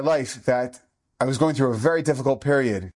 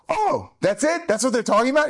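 put-on much higher, a child's relieved disbelief; relieved kid; 10 semitones higher than usual. Boy hears it's only under his hair: high relieved surprise. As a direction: sound like a little boy let off the hook.

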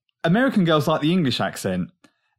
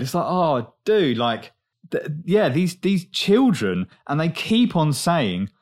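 ordinary talk

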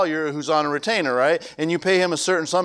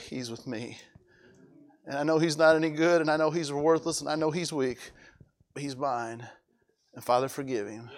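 low, much softer intercession; quiet plea; 3 semitones lower, 8 dB quieter than usual. Acted. Jesus quietly pleading before the Father for the sinner.

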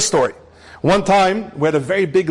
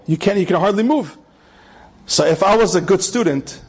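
ordinary talk, nothing marked

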